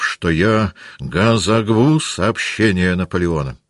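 slow vow with guttural R's; Denisov's burr. A narrator's reading. Officer vows to cut enemy lines, burred and emphatic. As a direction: say "газогву" with the R's softened to a throaty G. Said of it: "говорил Денисов".

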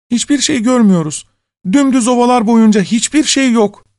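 high-pitched women's character voice; sisters on lookout. A narrator's reading. Sisters answering from the walls, high urgent report. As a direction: report back in a high calling voice.

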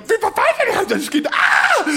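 very high, loud cashier; cashier shriek. Retold or imitated. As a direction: scream in shock as the cashier. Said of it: "Und die Kassiererin". (schreiende Kassiererin)

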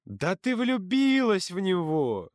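high, drawn-out gloating taunt. A narrator's reading. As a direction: stretch it out like a malicious tease. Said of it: "злорадно хмыкнул он".

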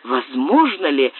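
ordinary delivery, nothing marked

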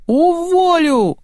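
very high, drawn-out shriek; screeched threat. A narrator's reading. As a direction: screech it in one long high wail. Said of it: "заверещал Андрей".

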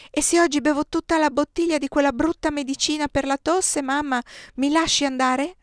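put-on a little boy striking a deal; child bargaining with mother. A narrator's reading.

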